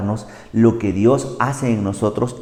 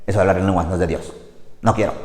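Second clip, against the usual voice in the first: low, fast, dismissive grumble; grumbling church member; 5 semitones lower, 4 dB quieter, much faster than usual. Retold or imitated. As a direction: brush it off, reluctant and sour. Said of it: "Y nosotros".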